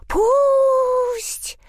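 very high loud squeak; squeaking bat. A narrator's reading. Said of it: "запищали летучие мыши".

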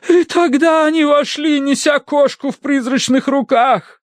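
very high, slow, dramatic climax; gasping like daughter. A narrator's reading. Wife describes spirits carrying the cat, awed gasp. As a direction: tell it slowly in high-voiced awe. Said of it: "Она ахнула, точь-в-точь, как Тосси".

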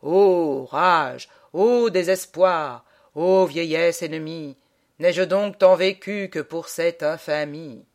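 loud, slow tragedian declaiming Corneille; tragic declamation. Acted. Mocking a man who recites tragedies alone, tragic declamation. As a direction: declaim it grandly, drawing out the vowels. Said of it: "qui se met à déclamer".

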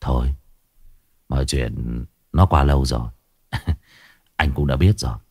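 husband calmly lets his wife down, low and gentle; gently spoken boss. A narrator's reading. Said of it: "nói chuyện rất nhẹ nhàng".

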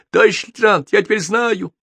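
high, squeaky eager voice; excited report. A narrator's reading. Mechanic reporting to his lieutenant, high and excited. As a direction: blurt it out excitedly, pitched high.

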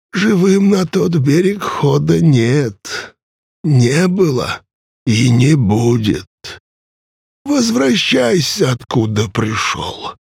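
put-on high, slow, grim old ferryman; stern ancient ferryman. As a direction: warn him off with weary menace. Charon refuses and threatens.

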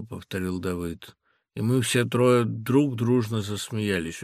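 plain reading voice

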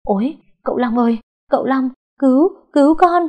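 rising panicked cry for help; terrified scream. A narrator's reading. Servant struck in the dark screams to his master. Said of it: "Thằng Ất hét lên".

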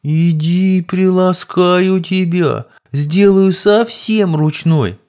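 high, very slow mock seduction; slow teasing coo. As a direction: draw it out like luring a pet. Predatory teasing approach.

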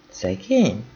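"Szegény?" asked back, knowing and doubtful, falling at the end; pointed question. Acted. Márta questioning Nelly's pity, knowingly echoing "Szegény?". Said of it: "Ezt úgy kérdezte Márta".

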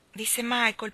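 usual speaking voice

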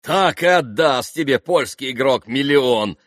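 loud mocking exclamation; exclaiming. A narrator's reading. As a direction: fling it out with scorn. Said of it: "воскликнул Митя". (громкое насмешливое восклицание)

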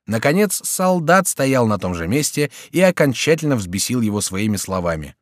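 plain reading voice

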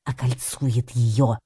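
slow, suspenseful reveal; excited repetition. A narrator's reading. Cat hiding under the bush, repeating it with excitement. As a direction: repeat it slowly with restrained excitement. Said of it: "взволнованно повторил я".

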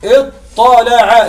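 اطلع split into slow separate sounds; word broken apart. Shown as a demonstration. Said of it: "لو فككنا اطلع يكون هكذا".

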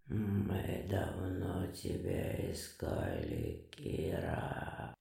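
deep, slow ghostly whisper; sepulchral voice. Acted. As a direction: whisper it from beyond the grave. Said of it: "загробным голосом вещало существо".

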